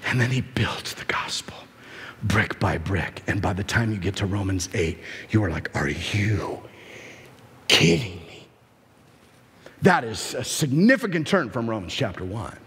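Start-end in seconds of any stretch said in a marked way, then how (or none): 0.1-8.5 low, near-whispered build-up; hushed, low preacher's wonder; hushed awe. Acted. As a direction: drop right down for dramatic weight. Hushed build-up after loud preaching.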